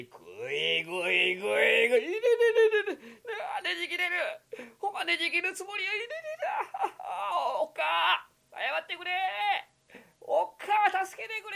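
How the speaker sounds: high wailing, half laughing; crying in pain. Acted. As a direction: whimper for mercy. Kokichi's neck being twisted. (泣きながら助けを求める)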